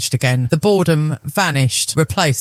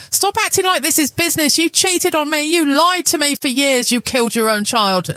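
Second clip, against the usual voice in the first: angry wife; 10 semitones higher than usual. A narrator's reading. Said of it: "The dam finally broke".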